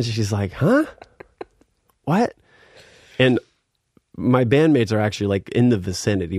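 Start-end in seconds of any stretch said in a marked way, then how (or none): 0.5-2.3 high-pitched puzzled questions; mock confusion, pitched way up; baffled. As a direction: imitate someone who has no clue. Voices the girl's confusion.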